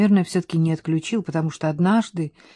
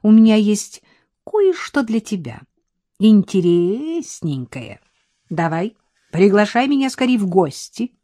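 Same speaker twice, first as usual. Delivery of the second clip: louder, higher, teasing woman's voice; flirty Milka; 3 semitones higher, 4 dB louder than usual. A narrator's reading. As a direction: coax him playfully. (кокетливо и звонко уговаривает Арсения)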